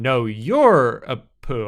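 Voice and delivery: "No, you're a poo." said slowly, with the weight landing on "poo"; slow, deliberate "No, you're a poo." that lands the punchline on "poo"; emphasis on poo. Shown as a demonstration. Showing how the knock-knock punchline should land, slow stress on "poo". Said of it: "put the emphasis on".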